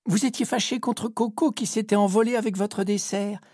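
put-on nervous young sailor stammering; stammering cabin boy. A narrator's reading. Cabin boy explaining to the captain, mild nervous stammer. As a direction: stumble over it, scared. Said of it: "bafouille Pépito".

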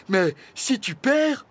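high, loud, slowed condition; pointed warning. A narrator's reading. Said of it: "précisa Flamèche". (avertissement appuyé, voix haute)

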